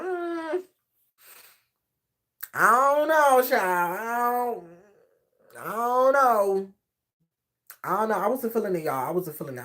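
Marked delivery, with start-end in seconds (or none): 0.0-8.2 hesitant mock reluctance. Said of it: "I was like".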